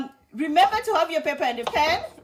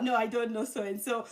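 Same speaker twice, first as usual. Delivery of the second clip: acted reply; 2 semitones lower, 7 dB quieter than usual. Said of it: "They ask you".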